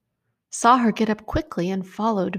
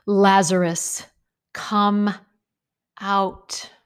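calling a name into a tomb, raised voice; loud call; 3 dB louder, slower than usual. Acted. Jesus calls Lazarus out of the tomb, loud command. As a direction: call him out, firm and loud. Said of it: "called in a loud voice".

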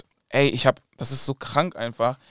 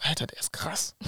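awed hush; 4 semitones higher, 9 dB quieter than usual. Real, not acted. Amazed at the videoguy's work.

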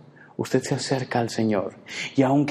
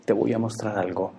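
softer, fond sigh and gentle invitation; tender God; 3 semitones lower, 6 dB quieter than usual. Acted. God embracing fearful Elijah, soft and tender. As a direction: hold him close with your voice. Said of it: "Y dice".

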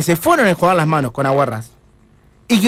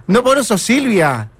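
ordinary talk

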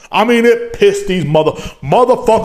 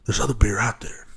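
whisper; 6 semitones lower, 8 dB quieter than usual. Acted. Confiding aside.

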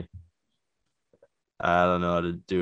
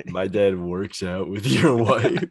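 mock disbelief; 7 dB louder, slower than usual. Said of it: "And I was like".

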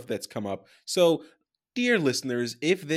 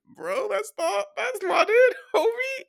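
pitched way up, laughing; high mocking voice; 4 dB louder than usual. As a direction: squeak it out mockingly. Retold retort to crypto bros.

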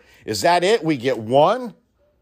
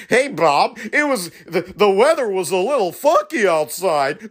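higher, hammed-up cheesy radio DJ patter; mock morning show host; 8 semitones higher than usual. Mocking the goofy morning show hosts, cheesy upbeat DJ voice. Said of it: "cackling morning shows".

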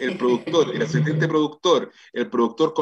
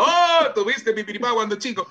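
mock gushing; 7 semitones higher than usual. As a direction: play a fan greeting an idol too eagerly. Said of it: "no puedo estar diciendo".

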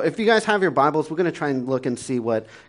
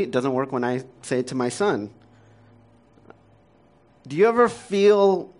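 ordinary talk

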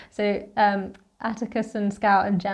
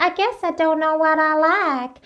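mock quote; 6 semitones higher than usual. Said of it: "she was like".

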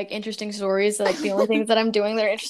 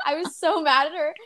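usual speaking voice

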